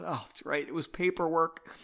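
usual speaking voice

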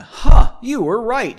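loud, high "huh" easing slightly; surprised realization. Acted. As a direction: blurt it out as if just discovering it. Character's surprised discovery, read aloud.

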